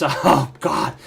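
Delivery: normal talking voice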